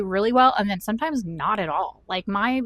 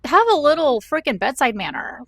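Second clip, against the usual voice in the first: louder, higher, fed-up plea; exasperated demand; 6 semitones higher, 6 dB louder than usual. Real, not acted. Vlogger complaining about the cold lab tech, exasperated demand. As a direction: scold him for no bedside manner.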